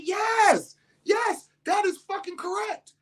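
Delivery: emphatic shout. Retold or imitated. Father answering daughter heatedly.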